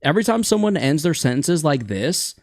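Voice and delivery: "this" lifted at the end like a question; uptone. Shown as a demonstration. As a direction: end on a rising "this", as if unfinished. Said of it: "with an uptone".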